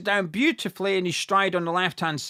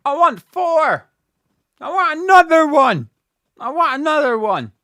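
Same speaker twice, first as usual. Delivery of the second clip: higher, louder, hungry pleading; eager demand; 7 semitones higher, 7 dB louder than usual. Real, not acted. Fan urging his team for a fourth, eager loud demand. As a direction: beg for another goal.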